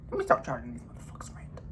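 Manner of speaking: a sly, hushed whisper for the planet. Retold or imitated. Vlogger mocks the planet in a hushed whisper. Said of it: "planet just woke up and was like".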